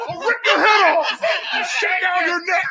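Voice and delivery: high, loud hammed-up threat with laughter; a laughing, shouted parody of menace; mock threat. Imitating the threatening customer.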